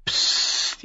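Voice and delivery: sharp whispered hush. Acted. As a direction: shush her urgently. Dontos hushes her. (scharf geflüstertes Psst)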